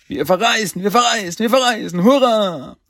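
high, loud group-style chant of joy; jubilant cheer. Acted. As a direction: cheer it out, building to a big hurrah. Turtles jubeln über die Reise. (lauter, hoher Jubel)

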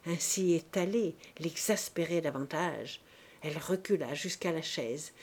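ordinary delivery

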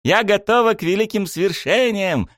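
very high, fired-up battle cry; militant cartoon princess. A narrator's reading. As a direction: proclaim it like a tiny warrior. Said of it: "воинственно вскинула она руку". (воинственный высокий возглас)

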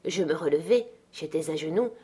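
usual speaking voice

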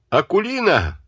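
calling out high across the room; high-pitched call; shouting a name. A narrator's reading. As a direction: yell for the servant girl. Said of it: "крикнул работнице".

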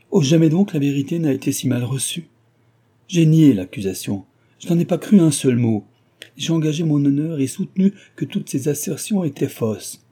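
beside himself. A narrator's reading. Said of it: "Et tout à fait hors de lui-même".

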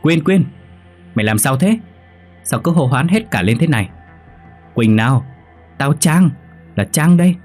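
high, urgent calling; alarmed rousing. Acted. Roommate tries to wake her raving friend, alarmed rousing. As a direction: snap a friend out of delirium. Trang rouses delirious friend.

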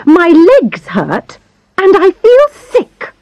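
high, pitiful whine; whiny. Acted. Ill patient complaining.

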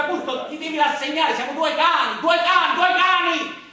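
exasperated partner's refrain, shouted; mock exasperated shout. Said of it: "io ti dico sempre".

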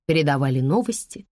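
plain reading voice

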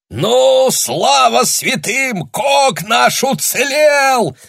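high, slow joyful shout; yelling from the shore. A narrator's reading. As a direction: bellow it across the beach. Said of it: "заорал кто-то на берегу".

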